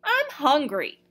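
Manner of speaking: high plaintive call out; whiny call. A narrator's reading. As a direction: whine across to him. Calling to the Magus.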